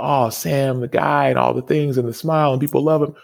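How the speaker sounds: high-pitched mock gushing; gushing admirer. Voicing what people think of Sam on sight, gushing. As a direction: imitate adoring people, pitched well up.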